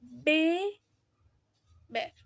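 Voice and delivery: "Be-" with lips closed, then "ver" with lips barely touching; demonstrating b sound. Shown as a demonstration. Student showing the class how b and v sound. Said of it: "suenan así".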